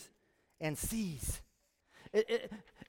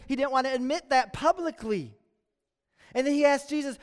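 normal talking voice

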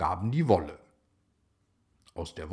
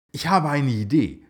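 a higher, louder eager cry; excited shout; 5 semitones higher, 6 dB louder than usual. A narrator's reading. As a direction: burst out with the idea, thrilled. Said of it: "schrie Sarah aufgeregt".

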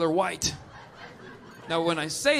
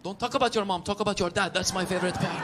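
put-on Persian accent; 5 dB quieter, faster than usual. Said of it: "He came up".

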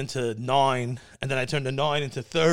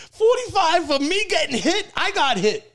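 high, loud outraged protest; retold indignant protest; 14 semitones higher, 5 dB louder than usual. Client retelling his protest to the law firm, indignant. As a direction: protest as if cheated, voice shooting up.

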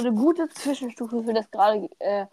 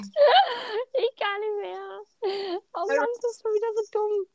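high, laughing giggle words; 9 semitones higher than usual. Real, not acted. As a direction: speak helplessly through laughter. Giggling fit over failed French.

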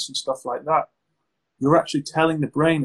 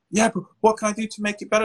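higher and fast, anxious pleading; mock panicky plea; 7 semitones higher, faster than usual. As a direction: play the client begging for a fix, rushing. Acted client pleading in panic.

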